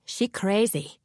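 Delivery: put-on a light Vietnamese accent, scornful old neighbour; "crazy" dropped with a light Vietnamese accent and disdain; disgusted old Vietnamese woman. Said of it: "a disgusted old Vietnamese woman".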